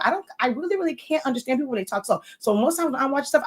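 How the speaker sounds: quick, run-on fast delivery; slipping back into fast speech; fast talk. Shown as a demonstration. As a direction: speed through it naturally. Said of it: "I'm talking like this".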